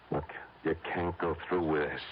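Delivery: a muted, urgent warning; hushed urgent plea. Acted. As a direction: keep it low but press hard.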